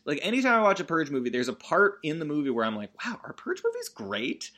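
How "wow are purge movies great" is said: high, softer awed question; hammed-up amazed aside; mock wonder. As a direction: marvel as if just realising it. Acts out own wonder while watching.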